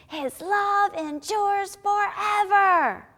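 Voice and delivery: high, slow, drawn-out shout; rousing shout, stretching each word; loud shout. Shown as a demonstration. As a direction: belt out the verse as loud as you can. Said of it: "as loud as you can".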